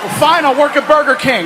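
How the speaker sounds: high, loud sulky giving up; resigned kid. Retold or imitated. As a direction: give up in a huff.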